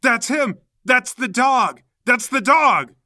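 high, loud accusing yell; alarmed shout. Acted. Heatstroke spots the culprit, shouting in alarm.

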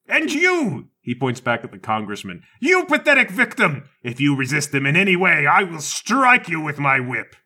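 put-on hammy old man ranting; exasperated rant. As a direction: scold them all at the top of his voice. Whip guy threatens congressman.

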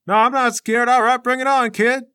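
high, loud blustering; defensive bravado. Acted. Other fighter accepting the kid's challenge, blustering bravado. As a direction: puff up against the challenger. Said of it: "The guy kinda looks around".

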